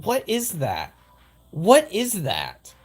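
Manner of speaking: drawn-out what is that; stunned, dragging the words; mock bafflement at the ad; slow disbelief. Real, not acted. Mocking the ad in disbelief.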